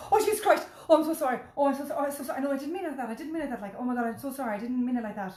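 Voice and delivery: high, loud, panicked over-apologising; flustered apology. Retold or imitated. Retelling the wedding guest's loud, flustered apology. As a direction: gush sorry in a flustered rush. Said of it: "myself and Nicola wet ourselves laughing".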